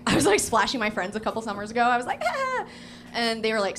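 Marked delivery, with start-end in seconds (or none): 2.3-2.7 very high, teasing "aah"; playful squeal. Retold or imitated. Comic splashing her friends at the river, playful squeal. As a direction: squeal like a pest splashing people.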